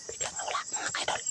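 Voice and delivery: faint unvoiced whisper. Real, not acted. Vlogger whispers to viewers that it vanished. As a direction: murmur so it won't notice.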